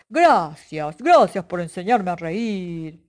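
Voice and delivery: a hint of the bear, thankful; grateful bear. The bear thanks Pepe, a lightly voiced grateful growl.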